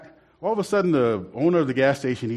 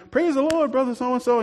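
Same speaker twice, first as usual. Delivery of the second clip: church greeting; 10 semitones higher than usual. Retold or imitated. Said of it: "everybody's walking around".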